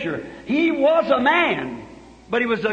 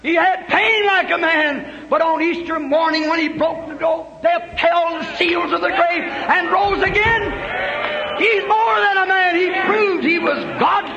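preaching at full force; 5 semitones higher than usual. Acted. Preacher proclaiming Easter to the congregation, triumphant shout. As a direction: shout the resurrection out.

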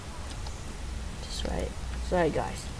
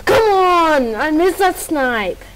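frustrated yell; 3 semitones higher, 6 dB louder, slower than usual. Real, not acted. Missed a sniper shot.

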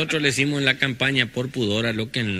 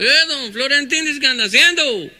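much higher, louder greeting; old healer voice; 9 dB louder than usual. Acted. Healer greeting the visitor from his door, high and loud. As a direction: call back, friendly and surprised.

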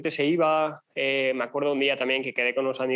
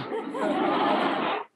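laugh. Real, not acted. Laughs telling the lung joke.

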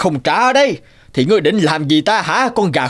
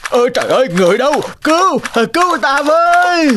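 panicked cry for help; 10 semitones higher than usual. Acted. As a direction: shriek for help as the house floods. Landlord flooded, crying for help.

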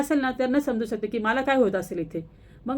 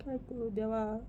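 much softer, near-tearful sigh to God; despairing lament; 9 dB quieter than usual. Real, not acted. Worried mother laments to God about her son, hushed and despairing. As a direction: pray in quiet despair.

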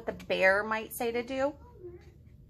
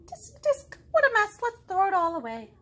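high, tut-tutting kangaroo; high disapproving kangaroo; 9 semitones higher, 6 dB louder than usual. Acted. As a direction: scold it like a fussy neat freak.

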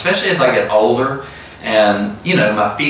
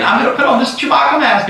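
giddy woman; 13 semitones higher, faster than usual. Retold or imitated. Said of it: "she videos herself saying".

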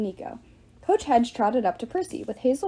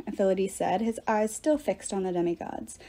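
ordinary delivery, nothing marked

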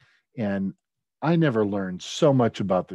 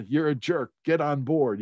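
mock scolding; 5 semitones higher, 5 dB louder than usual. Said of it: "responding to these emails with".

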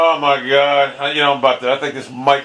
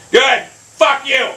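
high, bitter outburst; angry retort; 9 semitones higher, much slower than usual. Real, not acted. Gamer telling the game off, angry. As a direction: throw it back in real anger.

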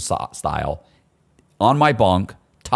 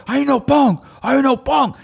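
high, louder imitation of the cellmate; mock hot-headed inmate; 6 dB louder than usual. Cellmate insisting he isn't a punk, high and loud. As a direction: bark it high like a riled giant. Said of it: "And he's like".

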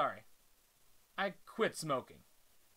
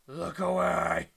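dramatic announcer; 9 dB louder, slower than usual. Acted. Announcing a new star.

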